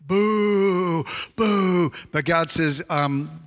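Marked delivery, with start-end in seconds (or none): 0.0-1.9 two long, sustained boos; mock booing. Preacher voicing Israel's reaction to the Babylonians, mock jeering. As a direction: jeer like a crowd hearing a hated name. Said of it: "they're thinking".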